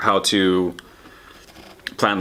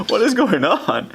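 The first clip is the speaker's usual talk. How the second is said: high-pitched disbelief; bewildered; 14 semitones higher, 3 dB louder, slower than usual. Retold or imitated. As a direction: act baffled, voice shooting up. Retold bewildered reaction.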